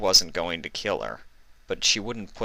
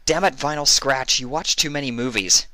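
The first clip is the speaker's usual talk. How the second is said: scolding herself; 8 semitones higher, 3 dB louder than usual. Acted. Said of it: "she said to herself".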